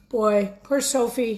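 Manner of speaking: ordinary delivery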